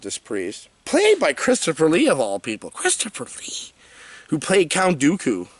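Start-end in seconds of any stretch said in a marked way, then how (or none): 2.7-3.7 high, airy, much softer incredulous repeat; hushed disbelief. Real, not acted. Reviewer amazed this actor took the role, hushed disbelief. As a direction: say the name as if you can't believe it.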